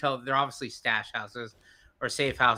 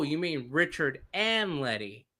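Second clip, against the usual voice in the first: "Richard and Letty" slow, each name separate; names spelled out slowly. Retold or imitated. Storyteller checking with his interpreter, "Richard and Letty" said slowly. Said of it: "he said it so fast".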